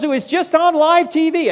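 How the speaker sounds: high, loud, frantic telling; alarmed excitement. Retold or imitated. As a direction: blurt it out in shock. Pastor frantically describing plane crash.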